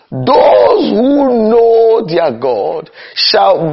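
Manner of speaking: slow, high, drawn-out preacher's cry on "Those"; preaching cry. Acted. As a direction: stretch "Those who know their God" out like a pulpit call. Repeats scripture, crying out.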